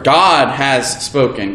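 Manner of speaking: "God" hit hard, slow and falling; stress on GOD. Shown as a demonstration. Preacher showing his class the stress on "God". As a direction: lean on the word "God". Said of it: "we placed the emphasis on each individual word".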